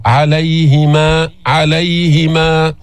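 slow chanted عليهما with a smooth ay glide; drawn-out recitation of عليهما; soft leen ya. Shown as a demonstration. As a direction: let the ي of عليهما glide softly, unvowelled. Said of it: "جبت اللين بالياء الساكنة".

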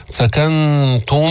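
"سكنتم" with ghunna on the meem; "سكنتم" with nasal ghunna. Shown as a demonstration. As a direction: hum the merged meem in "سكنتم". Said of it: "مع الغنة".

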